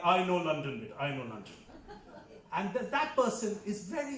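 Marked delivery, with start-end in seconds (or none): 0.0-1.5 put-on old Indian uncle. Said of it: "they're like".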